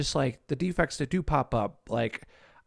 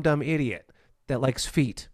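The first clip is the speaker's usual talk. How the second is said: usual speaking voice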